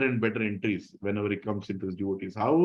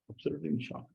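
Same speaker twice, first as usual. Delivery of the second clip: hushed repeat; 4 semitones higher, 9 dB quieter, much faster than usual. Shown as a demonstration. Teacher echoing the gopi's shock, much softer and awed.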